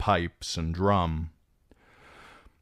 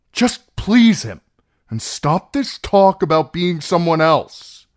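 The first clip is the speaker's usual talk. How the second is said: much higher, shouted and cracking; frantic cry; 11 semitones higher, 12 dB louder than usual. Acted. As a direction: cry it out in panic. Theon cries out.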